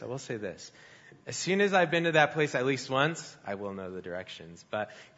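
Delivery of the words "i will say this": much softer and lower, drawn inward; quiet echo. Shown as a demonstration. Preacher softly echoing his own point to the congregation. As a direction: repeat it under your breath for weight. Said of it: "But I will say this".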